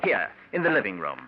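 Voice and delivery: usual speaking voice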